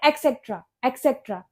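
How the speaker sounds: एटसेटरा as ek-SEK-tra, K sounds; quick ek-sek-tra for एटसेटरा; common mispronunciation. Shown as a demonstration. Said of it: "इसको हम बचपन से बोलते". (एटसेटरा का आम 'एक्सेक्ट्रा' उच्चारण)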